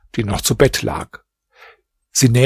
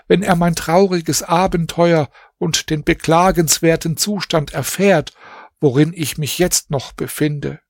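put-on higher, shaken young princess voice; plead, still stunned by the night; anxious young princess begging forgiveness; frightened princess; 5 semitones higher than usual.

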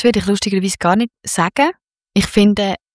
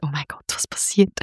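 hushed, anxious whisper to bandmates; retold insecurity; 3 semitones lower, 7 dB quieter than usual. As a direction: whisper it as if uneasy on stage. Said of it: "so mega unsicher geworden sind". (verunsichert geflüstert, nachgespielt)

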